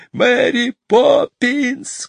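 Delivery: high, slow drawn-out call; desperate high shout of her name; children crying out. A narrator's reading. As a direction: call her back across the sky. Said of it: "кричали они".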